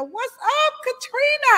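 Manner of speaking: high, bright shout-out to a friend; happy hello pitched well up; excited greeting. Real, not acted. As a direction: call her name with a big lift. Greeting a viewer by name.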